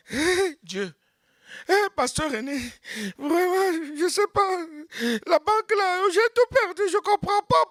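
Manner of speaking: high, pleading lament to the pastor; high and tearful complaint about losing everything at the bank; whining believer. Acted. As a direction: play a desperate churchgoer crying out.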